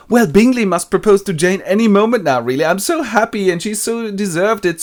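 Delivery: high, loud overjoyed gush; loud gushing mother. Retold or imitated. Embarrassing mother boasting at the ball, high and loud. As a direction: gush loud enough for the whole ballroom. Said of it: "so loud that everybody can hear it".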